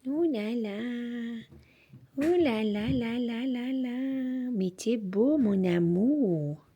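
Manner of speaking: slow, soft cooing to the dog; tender dog talk. Real, not acted. Parle tendrement à son chien Malo. (voix douce et lente pour câliner son chien)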